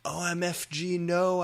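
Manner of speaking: high, loud hammed-up scream; mock scream. A narrator's reading. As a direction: squeal it out as you fall. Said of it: "I screamed as I fell down".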